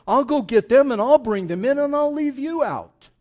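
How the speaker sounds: loud, high-pitched royal outrage; heated, forceful preacher voicing an angry king; indignant king. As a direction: declare it like a spurned host. Snubbed king answers absent guests.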